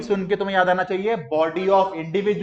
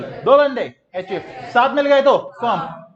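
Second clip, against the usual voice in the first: loud classroom chant, rising in pitch; teacher calling out a memory chant; leading a recitation; 3 semitones higher, 6 dB louder than usual. Shown as a demonstration. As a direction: drive the drill louder and higher. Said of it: "मेरे साथ बोलना है".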